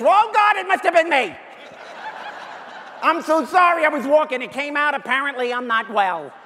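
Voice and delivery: high, loud mock-panicked confession, easing off; hammed-up frantic apology from a guilty dog. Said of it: "guilty look on their face".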